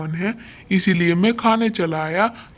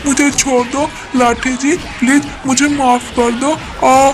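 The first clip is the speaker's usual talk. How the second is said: high, loud, pained begging with yelps; pleading cry; 6 semitones higher, 5 dB louder than usual. Acted. Crow being beaten, begging the stick for mercy in pain. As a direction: wail for mercy, much higher and louder.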